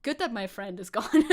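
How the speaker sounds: usual speaking voice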